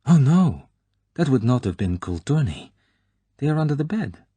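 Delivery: put-on high, bright young woman's voice; girl's voice. A narrator's reading. Girl tells Bond her clothes are under the bed, high bright voice. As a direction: answer lightly, pitched high and lively.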